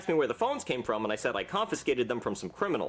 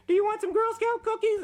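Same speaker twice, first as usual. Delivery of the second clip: put-on high, louder little girl voice; 4 dB louder than usual. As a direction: chirp it like a Girl Scout. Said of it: "and they say".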